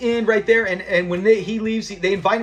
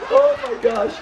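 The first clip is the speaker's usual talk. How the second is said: recovering from laughter; 5 semitones higher than usual. Real, not acted.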